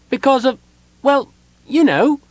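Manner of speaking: high, loud, hesitant character voice; calling out. A narrator's reading. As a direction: pipe up loudly, halting between phrases. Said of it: "Tass called out".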